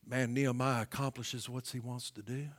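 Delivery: usual speaking voice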